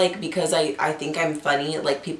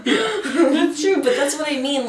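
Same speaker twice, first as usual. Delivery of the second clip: high laughing reply; giggling while agreeing; 9 semitones higher than usual. Real, not acted. Laughing together.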